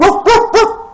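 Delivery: high, loud mock woofs; dog barking. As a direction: bark like a yappy little dog. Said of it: "Like a dog?".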